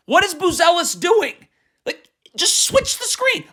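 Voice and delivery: high-pitched, incredulous outburst; exasperated. Real, not acted. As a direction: plead in disbelief, climbing very high. Exasperated at a player's mistake.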